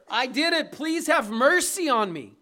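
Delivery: mock prisoner loudly begging mercy. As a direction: cry out for mercy like a guilty prisoner.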